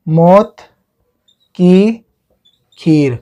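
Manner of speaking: long pause between each word; word-by-word dictation. Shown as a demonstration. Teacher giving students a new memory trick, word by word. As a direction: dictate slowly, one word at a time.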